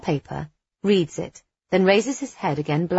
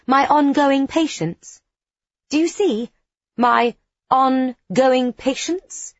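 incredulous; 8 semitones higher, 4 dB louder, slower than usual. A narrator's reading. Incredulous repeated quote.

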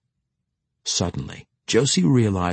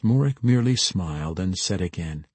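ordinary delivery

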